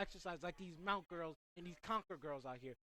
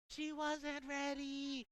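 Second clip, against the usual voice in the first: high, very slow sustained call; long wailing announcer call; drawn-out cry; 6 semitones higher, much slower than usual. Real, not acted. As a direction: stretch every word out on a high held note. Commentator's drawn-out cry after failed play.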